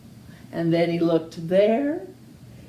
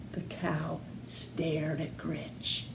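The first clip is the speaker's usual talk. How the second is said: whisper; 4 semitones lower, 14 dB quieter than usual. A narrator's reading. Hushed narration beat.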